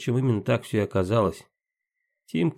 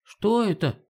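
frightened cry; 11 semitones higher, slower than usual. Acted. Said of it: "вскрикнул Тимофей испуганно".

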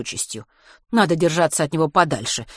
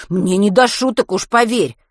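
insistent; 4 semitones higher, 6 dB louder than usual. A narrator's reading. Matvey insisting angrily.

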